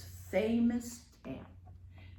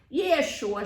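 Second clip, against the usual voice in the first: louder, smirking brush-off; mock princess smirking reply; 4 semitones higher, 9 dB louder than usual. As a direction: toss it off with a smirk. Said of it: "she answered with a smirk".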